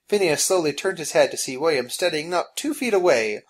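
usual speaking voice

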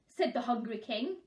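ordinary delivery

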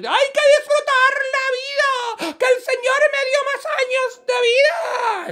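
high, loud whoop of joy; jubilant cry. Acted. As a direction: exult in a shrieky high voice. Woman exults in her new life.

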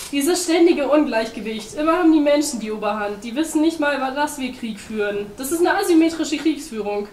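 loud, high-pitched tirade; agitated rant. A narrator's reading. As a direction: rail against the injustice. Penguin rants at imbalance.

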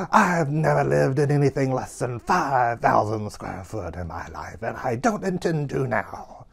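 grand, hammed-up diva declamation; slow, theatrical outrage, every word drawn out; Norma Desmond. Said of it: "turn into Norma Desmond and go like".

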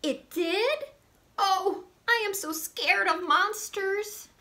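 put-on high, childlike puppet voice; high Grover-style voice. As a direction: read it as a high, eager little monster. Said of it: "Starring lovable furry old Grover".

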